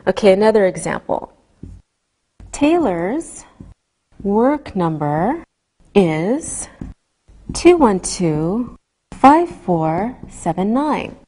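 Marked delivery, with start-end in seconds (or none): 2.5-11.2 slow, each digit separate. Shown as a demonstration. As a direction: read the digits one by one. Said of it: "So we say".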